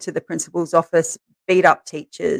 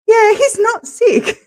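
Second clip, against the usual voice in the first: very high, slow sing-song; exaggerated knowing; 4 dB louder, slower than usual. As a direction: stretch it out triumphantly. Mother's triumphant reply, very high.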